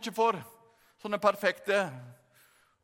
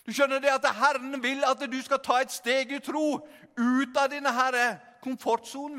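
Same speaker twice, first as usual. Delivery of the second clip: normal talking voice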